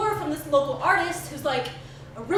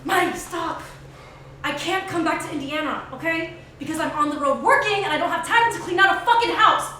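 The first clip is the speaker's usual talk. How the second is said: angry phone shouting; 3 semitones higher, 7 dB louder than usual. Acted. Heated phone argument.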